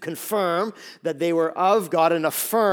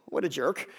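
usual speaking voice

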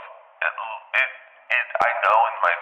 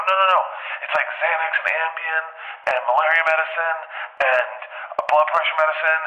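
high, urgent retold objection; high-pitched, exasperated listing; alarmed protest; 7 semitones higher than usual. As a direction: insist in mild mock panic. Alarmed argument over pills the baby ate.

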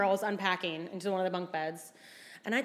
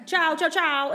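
hurried goodbye; 7 semitones higher, 6 dB louder, slower than usual. Retold or imitated. Trying to leave the gondolier.

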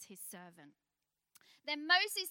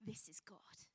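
whisper; 7 semitones lower, 12 dB quieter than usual. Acted. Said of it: "hushed awed quote".

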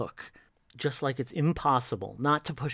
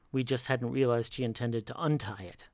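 plain reading voice

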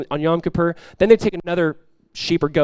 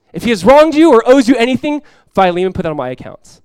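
higher, louder quote, full of conviction; emphatic loud reading; 5 semitones higher, 6 dB louder than usual. Shown as a demonstration. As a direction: proclaim the verse with fire. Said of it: "What a beautiful phrase".

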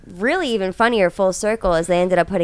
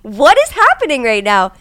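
raised, bewildered protest; startled disbelief; 6 semitones higher, 7 dB louder than usual. Retold or imitated. Realtor protesting to the cop, raised and baffled. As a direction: act baffled holding the folder. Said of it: "I'm like".

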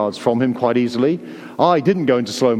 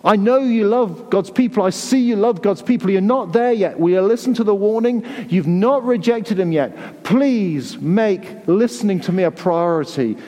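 earnest urging; 6 semitones higher than usual. Retold or imitated. The Lord urging the Hebrew Christians to listen, earnestly. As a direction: press them to listen, urgently but kindly. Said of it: "says the Lord".

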